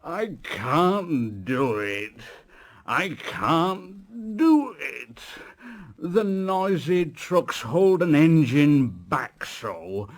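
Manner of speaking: very slow, effortful complaint; a tired engine grumbling it can't; straining engine. A narrator's reading. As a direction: drag the words out like an engine stuck on a hill. Gordon strains up the hill.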